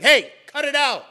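sudden loud, high command; sharp rebuke. Shown as a demonstration. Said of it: "This is sharp".

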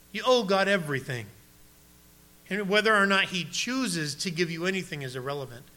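ordinary talk, nothing marked

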